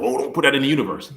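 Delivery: a put-on voice of a scolding churchgoer; mock scolding voice. Preacher mimicking people who hush honest feelings, mock scolding. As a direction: lightly imitate the superstitious warning. Mocking what people tell him.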